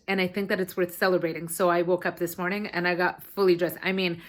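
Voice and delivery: usual speaking voice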